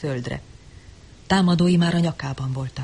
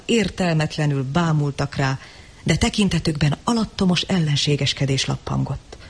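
ordinary delivery

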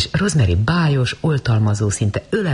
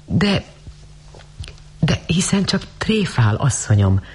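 pained; 6 semitones higher than usual. A narrator's reading. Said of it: "mondta a lány fájdalmasan".

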